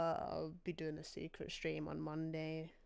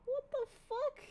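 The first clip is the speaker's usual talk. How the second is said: disbelief. Real, not acted. Streamer baffled.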